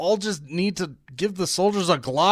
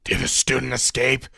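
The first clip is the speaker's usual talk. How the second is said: mock general; 4 semitones lower than usual. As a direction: impersonate a grim commanding general. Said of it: "And he's like".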